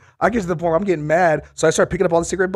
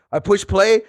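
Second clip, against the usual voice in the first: ordinary talk, nothing marked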